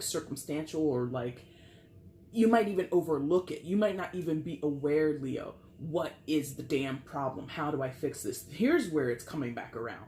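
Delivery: normal talking voice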